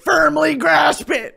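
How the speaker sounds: slow, loud, high cartoon exclamation; mock shout, every word stretched; loud cartoon quote. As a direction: belt it out like a cartoon character, drawn out. Quoting a shouted SpongeBob cartoon line.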